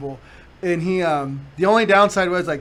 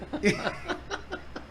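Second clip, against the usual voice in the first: high laugh; 6 semitones higher, 9 dB quieter than usual. Real, not acted. Laughing at own story.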